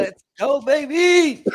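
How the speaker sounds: loud, high victory yell; hyped cheer. Real, not acted. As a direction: cheer it like a big win. Hyped cheer over coin.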